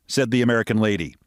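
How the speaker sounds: ordinary delivery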